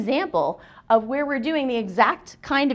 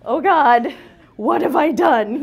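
loud, higher, hammed-up dismay; mock dread; 6 semitones higher, 6 dB louder, slower than usual. Speaker recalling agreeing to pyro and dancers, in mock dread. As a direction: wail it in comic regret. Retelling her dread at their offer.

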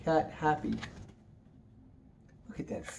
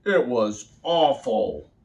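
grumpy cat voice; 4 dB louder, slower than usual. Acted.

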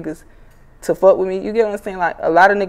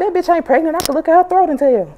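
imitating mom; 11 semitones higher than usual. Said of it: "And she was like".

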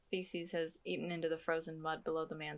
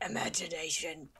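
whispered character voice; hushed, voiceless whisper. Acted. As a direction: whisper it out like an eerie figure. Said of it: "I need to make a voice for you".

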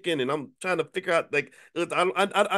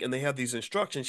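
usual speaking voice

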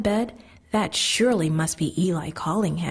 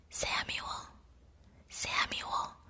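soft breathy call from afar; a distant whispered call; gentle whisper; 15 dB quieter, much slower than usual. A narrator's reading. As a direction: call his name gently, barely voiced. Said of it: "the Lord said in a gentle voice".

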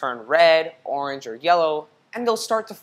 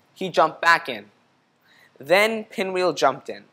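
usual speaking voice

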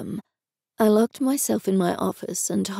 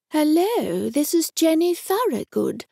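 higher put-on voice, faking another woman; imitating Jenny; 8 semitones higher than usual. Higher put-on voice. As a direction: narrator fakes Jenny's voice on the phone. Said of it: "an imitation of Jenny's voice".

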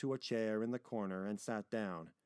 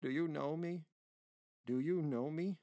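put-on higher, lilting questions to a child; tender old man; 6 semitones higher than usual. Grandfather asking little granddaughter if she knows him, tender. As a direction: coax her to remember, pitched up.

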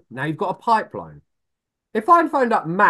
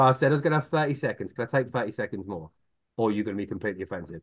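softer, quicker, lower cheeky ask; quiet cheeky pitch; 8 semitones lower, 7 dB quieter, faster than usual. Shown as a demonstration. To a prospect who said no, a lightly voiced quick cheeky ask. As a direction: slip the extra request in lightly. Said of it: "And I go".

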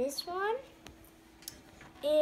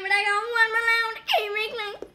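whiny protest; 7 semitones higher, 5 dB louder than usual. Real, not acted.

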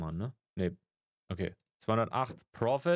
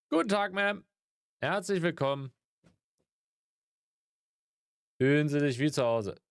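shopkeeper greeting; 5 semitones higher, 3 dB louder than usual. Pretend.

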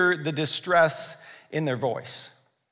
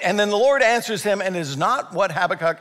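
ordinary delivery

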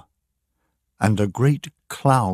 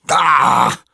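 loud, exasperated "ah"; loud cry of disgust; angry cry; 6 semitones higher, 8 dB louder than usual. A narrator's reading. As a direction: burst out with a loud growl of frustration. Captain pushes Daniel away angrily.

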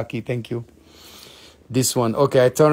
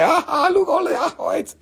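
Ah, look pitched much higher, gasping in wonder; high excited exclaim. Pretend. To viewers, squealing with high delight. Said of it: "and say".

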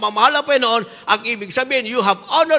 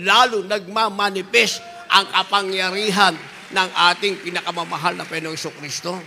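usual speaking voice

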